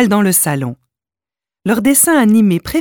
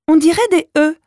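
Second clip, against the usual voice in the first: put-on child voice; 9 semitones higher, faster than usual. A narrator's reading. Malika voiced by narrator.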